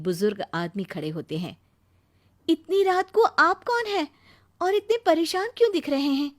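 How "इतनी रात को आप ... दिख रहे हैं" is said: surprised. Acted. Nisha opens door at night.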